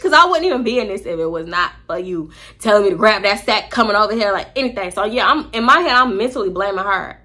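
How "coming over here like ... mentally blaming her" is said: usual speaking voice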